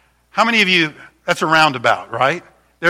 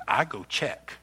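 put-on neighbour's accent, softer; 3 semitones lower, 10 dB quieter than usual. Neighbour reassuring about checking on mom, imitated accent. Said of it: "not making fun of his accent".

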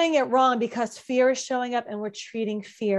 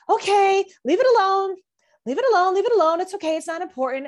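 higher and louder, chanting the rule at yourself; mock self-coaching; 7 semitones higher, 4 dB louder, faster than usual. Host mocking people who treat advice as steps, mock self-coaching. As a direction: parrot the steps like a checklist.

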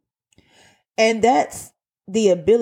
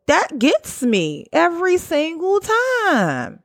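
high, slow, drawn-out exclamation; theatrical exasperation; 10 semitones higher, slower than usual. Real, not acted. Worker venting about manipulative bosses, theatrically exasperated. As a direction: stretch it out high for dramatic effect.

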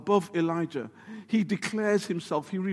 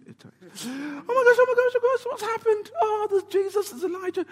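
much higher, breathless gushing; excited Peter; 14 semitones higher than usual. Acted. Peter spotting Jesus and Elijah, giddy high-pitched gushing. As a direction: babble in giddy disbelief. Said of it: "at this point gets really excited".

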